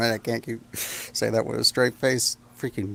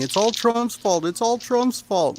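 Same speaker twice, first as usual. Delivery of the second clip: high, slow mocking whine; 8 semitones higher, slower than usual. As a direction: parody a ranting conspiracy fan. Mocking a conspiracy rant.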